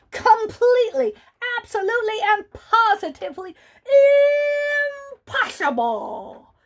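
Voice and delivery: each word punched high and loud; emphatic bunny refusal. Acted. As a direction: hammer every word.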